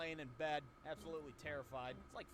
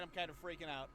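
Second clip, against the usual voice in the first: usual speaking voice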